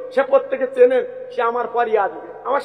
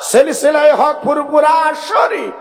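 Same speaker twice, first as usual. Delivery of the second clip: slow, loud rally cry, higher in pitch; slogan shout; 4 semitones higher, 6 dB louder, slower than usual. Real, not acted. Preacher leads the crowd in a religious slogan, slow loud shout. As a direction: bellow each word so the crowd can echo it.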